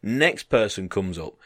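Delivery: usual speaking voice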